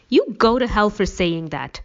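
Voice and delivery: angry yell. A narrator's reading. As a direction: lash out loudly, pitch lifted. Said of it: "I yelled at the top of my lungs".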